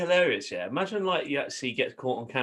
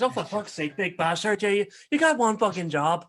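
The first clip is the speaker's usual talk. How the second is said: higher, exasperated put-on Northern Irish; Northern Irish accent; 7 semitones higher, 5 dB louder than usual. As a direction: berate the crew in mock Northern Irish. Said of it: "He goes".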